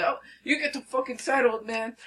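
high, loud imitation of a hothead; mock aggression. Fisherman squaring up to Jerry, mock aggression. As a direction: snap it at an older man.

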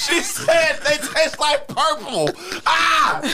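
high shriek of laughter; laughing shout. Real, not acted.

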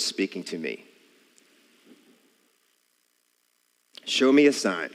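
measured Bible reading with voiced parts; earnest scripture reading voice. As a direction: read it out like scripture before a congregation. Reads the scripture aloud.

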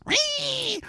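very high shrill piggy squeal; mocking squeal. Mocking critics online, a high piggy squeal. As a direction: squeal like a pig to mock them. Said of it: "squealing like piggies".

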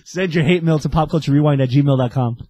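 loud mock radio plug; announcer voice. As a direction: boom it out like an ad.

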